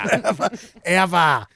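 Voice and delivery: put-on Australian accent. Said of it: "It's so hard to do Australian".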